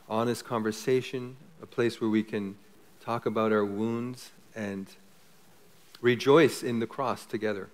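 hushed, measured congregational prayer; pastoral prayer. Real, not acted. Pastor leading the congregation to faith, soft prayer. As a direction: pray softly, pausing between phrases.

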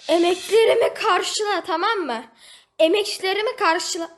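exasperated demand to a pet; demanding thanks. Real, not acted. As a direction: make it repay your effort, loud and pushy. Scolding the tame animal.

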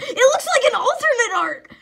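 high, loud, shrieking joy; screaming excitement. Real, not acted. Kid screaming over a rare card pull. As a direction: scream it out in real disbelief. Pulls alt art, screams.

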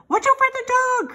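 high, loud sheep cry; high-pitched alarm; sheep crying warning. A narrator's reading. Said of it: "cried the sheep".

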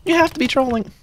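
high-pitched disbelief; startled outcry. Real, not acted. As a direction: cry out in high-pitched alarm at the game. Sudden mishap in the game.

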